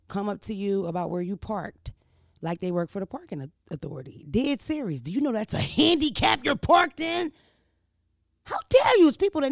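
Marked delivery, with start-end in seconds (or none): 5.0-9.5 high, loud indignant busybody; shrill-free but high, loud mock indignation. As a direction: play the outraged parking scold.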